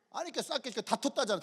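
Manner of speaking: usual speaking voice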